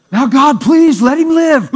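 high, loud, slow hammed-up plea; joking plea to God over the tub; mock prayer. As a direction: ham up a desperate prayer.

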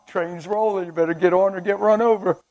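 usual speaking voice

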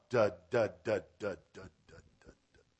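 repeated da, dying away to nothing; staccato das fading out like an echo. Real, not acted. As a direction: let the syllables trail off into silence. Imitates an echoing, fading refrain.

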